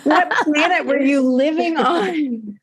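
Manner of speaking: hammed-up disbelief that climbs in pitch; mock incredulity. Guest teasing the interviewer for her change of tone, mock incredulous. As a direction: imitate a shocked interviewer, rising to a high, drawn-out finish. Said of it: "your tone of your voice just changed so much".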